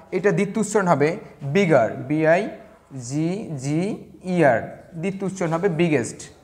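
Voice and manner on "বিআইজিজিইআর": very slow, separated letters; spelling out. Shown as a demonstration. Teacher showing the doubled consonant in bigger, slow spelling out. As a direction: space out each letter for learners.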